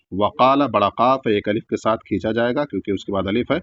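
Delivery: normal talking voice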